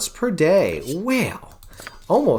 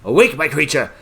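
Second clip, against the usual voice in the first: commanding wizard; 4 semitones higher, 3 dB louder than usual. Acted. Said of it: "He says".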